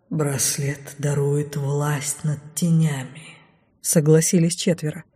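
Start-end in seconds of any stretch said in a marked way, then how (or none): 0.1-3.4 slow, low, soft shadow voice; dark whisper behind you. A narrator's reading. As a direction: speak as darkness itself. Shadows answer her. (тёмный шёпот за спиной)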